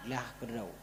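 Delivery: dropped low and soft, a murmured echo; quiet echo. Acted. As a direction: let the repeat fall away softly. Preacher's call-and-echo list. (gema pelan dan lirih)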